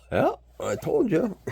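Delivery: told-you-so. Retold or imitated. Told fellow drivers about Quebecer.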